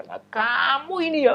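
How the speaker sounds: scolding mother. Acted.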